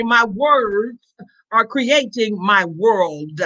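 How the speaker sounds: slow, weighty words to be written up; slow declaration. Shown as a demonstration. Pastor dictating a slogan for the church screen, slow and deliberate. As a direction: spell it out slowly for the screen. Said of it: "somebody needs to put up there for me".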